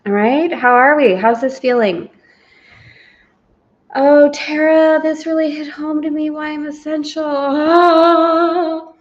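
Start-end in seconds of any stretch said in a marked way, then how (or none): 3.9-8.9 gushing student. Retold or imitated. Student's gushing message read aloud.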